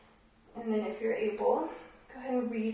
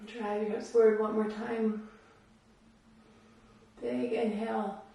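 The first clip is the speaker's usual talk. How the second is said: usual speaking voice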